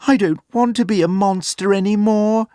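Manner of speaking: a gentle bear who is tired of scaring; lightly sorrowful plea; sad bear. A narrator's reading. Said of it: "thought Ted sadly".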